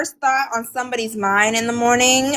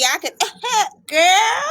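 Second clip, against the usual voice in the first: put-on bubbly high Valley Girl tone; playful Valley Girl, laughing through it; 10 semitones higher, slower than usual. Shown as a demonstration. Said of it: "Don't let me pull out my Valley Girl voice".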